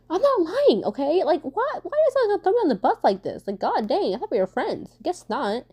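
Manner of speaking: high-pitched, exasperated mock outrage; indignant protest. Protesting to friends who threw her under the bus, indignant. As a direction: protest in a high, betrayed wail, easing off at the end.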